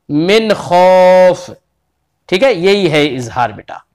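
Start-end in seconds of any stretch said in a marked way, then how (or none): 0.1-1.5 मिन खौफ with a clean, clear न, very slow; clear izhar. Shown as a demonstration. Teacher repeating the correct clear reading for a student. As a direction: say मिन crisply without nasal hum. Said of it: "बल्कि क्या पढ़ेंगे".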